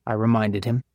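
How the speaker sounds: usual speaking voice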